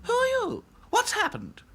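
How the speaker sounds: very high, startled doll voice; alarmed. A narrator's reading. Princess dolls questioning a stranger, alarmed. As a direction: ask in high-pitched alarm. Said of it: "asked the dolls in alarm".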